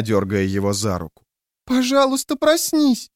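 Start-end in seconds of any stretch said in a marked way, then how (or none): 1.6-3.1 put-on pleading child voice. A narrator's reading. Girl begs dead father.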